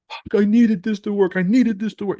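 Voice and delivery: high, loud mock panic, repeating himself; desperate trader. Mocking a desperate trader who needed the trade, high and loud. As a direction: play a broke trader losing it over one trade. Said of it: "sit here and like".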